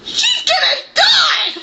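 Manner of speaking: high laughing cry of dismay; laughing shout. Real, not acted. Telling viewers a character dies, laughing shout.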